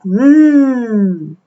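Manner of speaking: a pleased, savouring hum; contented little mm; monkey's happy mm. Acted. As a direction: hum like a monkey tasting a treat. George savours chocolate.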